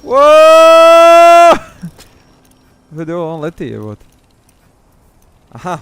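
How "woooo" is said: loud high whoop; excited whoop. Real, not acted. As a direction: whoop with delight. Tossing drone into air.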